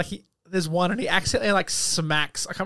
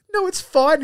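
put-on high, louder boy's "No, it's fine"; mock reassurance; 11 semitones higher, 7 dB louder than usual. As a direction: brush it off in a high voice. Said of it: "Daniel Radcliffe's like".

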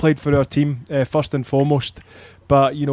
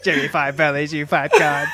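a lightly voiced robot insult, laughing at the end; a hint of mechanical robot calling out; retold robot prank greeting, barely robotic. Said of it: "this robot come in the room".

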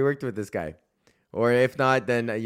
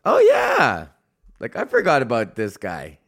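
mock acquaintance; 5 dB louder than usual. Imitating Facebook well-wishers in a mock surprised voice. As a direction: play the half-remembering friend, pitch leaping. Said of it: "people that wish you happy birthday are like".

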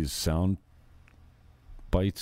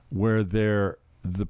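usual speaking voice

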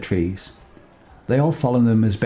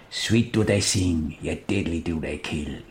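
solemn recitation; 5 semitones lower, 5 dB quieter, slower than usual. Acted. Quoting the old song.

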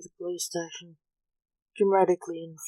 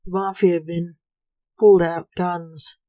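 plain reading voice

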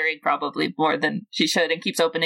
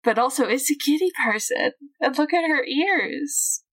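gushing excited; 7 semitones higher than usual. Acted. Gushing over cat person.

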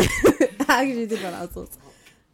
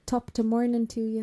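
slow, playful Irish accent. Shown as a demonstration. Showing friends an Irish accent, slow and playful. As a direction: put on a stock Irish greeting lilt. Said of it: "I'll say it in Irish".

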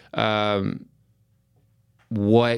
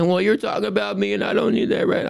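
higher imitation of a hurt young daughter; imitating his daughter; 9 semitones higher than usual. Dad retelling daughter scolding him, higher mock child voice. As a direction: pitch up to voice the child pushing back.

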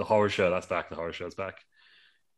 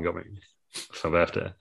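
usual speaking voice

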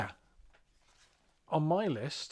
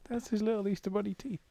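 bunny voice; 8 semitones higher, 3 dB quieter than usual. Pretend. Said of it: "I did not care for that sound".

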